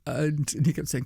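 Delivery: normal talking voice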